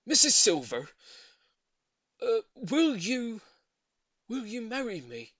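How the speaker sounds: put-on a shy old man stammering out a proposal, slowly at first; nervous proposal. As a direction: Mr Hoppy nervously asks Mrs Silver to marry him.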